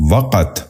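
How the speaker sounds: وقت said as "vaq-at", short a after v; correct pronunciation. Shown as a demonstration. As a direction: open وقت with "va". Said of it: "درست تلفظ ہے".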